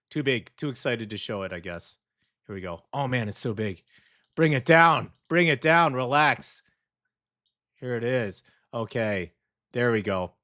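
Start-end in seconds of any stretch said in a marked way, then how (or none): 4.3-5.9 1 urging. Real, not acted. Urging the PD to shrink pictures.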